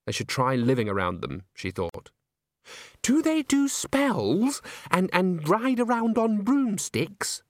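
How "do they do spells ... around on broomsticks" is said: very high, slow character voice; wide-eyed fascination. Acted. Christine asking Agnes about witches' spells, wide-eyed fascination. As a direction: marvel in a high, drawn-out gush.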